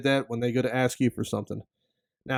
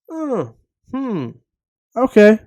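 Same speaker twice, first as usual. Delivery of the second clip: high, then a loud sarcastic Okay; mock being let down, dripping with sarcasm; 7 semitones higher, 7 dB louder, slower than usual. Vlogger mocking a friend who won't help, sarcastic.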